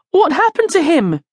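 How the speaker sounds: put-on high, alarmed child's question; panicked. A narrator's reading. Louise sees the dog vanish, panicked. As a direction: ask it with panic in your voice. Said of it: "panic in her voice".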